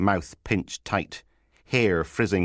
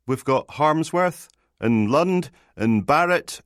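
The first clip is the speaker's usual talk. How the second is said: high, loud roll call; constable listing names; 9 semitones higher, 5 dB louder than usual. Acted. Constable tells sergeant who else is there, high-voiced list. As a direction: count them off in a high voice.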